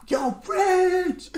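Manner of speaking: high yell out the window; calling out. Retold or imitated. Brother yelling out the window for Rich, high call. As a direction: shout his name from upstairs.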